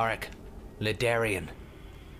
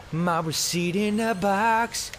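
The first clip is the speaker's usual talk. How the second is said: slow, drawn-out higher voice; mock quote; 5 semitones higher, slower than usual. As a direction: draw every word out in a put-on voice. Mimics the receipt finding, drawn out.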